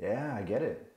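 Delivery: very soft, understanding reply; acted gentle counsellor, hushed; empathetic. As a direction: quietly let him know he is heard. Validating the dad's burden.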